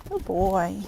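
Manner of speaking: low, soft murmur to the horse; soft, low praise for the horse. Real, not acted.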